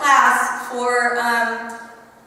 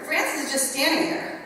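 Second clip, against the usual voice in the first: normal talking voice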